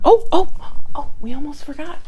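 sudden realization. Real, not acted.

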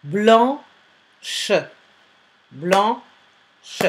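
"Blanche" cut into blan, pause, che; syllable by syllable. Shown as a demonstration. Teacher dictating a word to pupils, "Blanche" syllable by syllable. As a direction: split each "Blanche" before a fully sounded che. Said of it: "Travaillez par syllabe".

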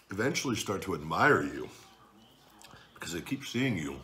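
ordinary talk

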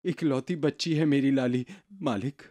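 meek. Acted. Beggar answers about only daughter.

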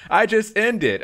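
loud, high-pitched comic insistence; mock protest. Streamer telling a chatter they just ended, mock outburst. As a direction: blurt it out high and exaggerated.

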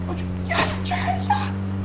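normal talking voice

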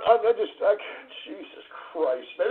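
ordinary talk, nothing marked

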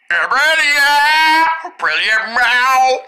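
silly, drawn-out cat-like meow; funny voice. Acted. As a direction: stretch the nonsense words for laughs. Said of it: "I'll try making my voice funny".

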